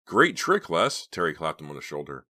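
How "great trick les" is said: enthusiastic. A narrator's reading. Congratulating the prankster.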